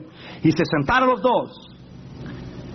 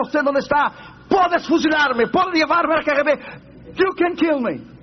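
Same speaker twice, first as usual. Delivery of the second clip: high, slow pouring out of everything; desperate confession; 9 semitones higher than usual. Retold or imitated. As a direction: blurt it all out in a high voice. Pastor confesses to KGB guard.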